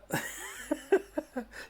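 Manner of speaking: high airy burst of laughter; real incredulous laugh, mostly breath; high breathy laugh. Real, not acted. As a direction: laugh out at the absurdity. Laughs at the panel.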